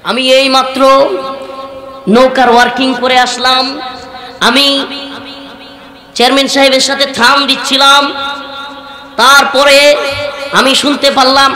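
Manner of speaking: mock leader orating in halting phrases with long pauses; politician's speech. Local leader takes the microphone before an angry crowd, mock oratory. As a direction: imitate a self-important leader at the mic. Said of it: "নেতামি স্টাইলের বক্তব্য".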